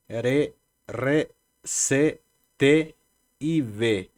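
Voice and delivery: teacher sounding each consonant clearly; oral consonants sounded out. Shown as a demonstration. Teacher to students, reading oral consonants. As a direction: say "she", "ge", "je" one by one, pausing. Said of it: "¿Cuáles son las orales?".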